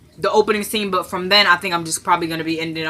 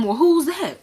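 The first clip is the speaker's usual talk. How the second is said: curious woman; 9 semitones higher, slower than usual. Retold or imitated. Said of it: "She gonna say".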